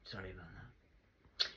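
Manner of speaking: soft, slow whispered apology. Real, not acted. A speaker apologising to viewers in a slow whisper. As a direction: murmur it slowly under your breath. Quiet apology after outburst.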